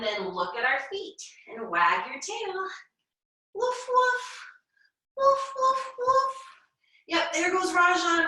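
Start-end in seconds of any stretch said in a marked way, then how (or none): 3.5-6.3 high playful barks; dog barking. Pretend. Teacher barking for the kids in dog pose, high and playful. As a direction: bark like a happy puppy.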